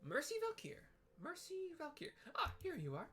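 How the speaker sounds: very high, polite and eager; tiny helpful clerk, very high; sweet high Lalafell. Acted. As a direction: squeak out an apology and ask sweetly. Tataru writing down the name.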